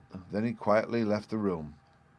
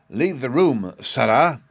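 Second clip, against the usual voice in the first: louder, stern order with held-back force; as loud as he dared; 5 semitones higher, 7 dB louder, slower than usual. A narrator's reading. Father ordering his weeping son out, as loud as he dares. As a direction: send the boy out without letting it become a shout. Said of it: "he said as loud as he dared".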